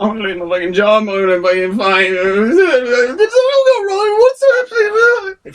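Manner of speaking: hammed-up wailing, climbing very high; full breakdown. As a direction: blubber the words into a drawn-out wail. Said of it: "Full breakdown".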